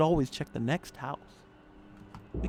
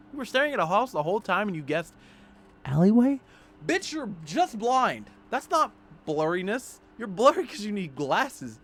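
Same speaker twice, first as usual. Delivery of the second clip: exasperated mock scolding; 7 semitones higher, 7 dB louder than usual. Player berating the character's eyesight, loudly incredulous. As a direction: scold the clueless hero loudly.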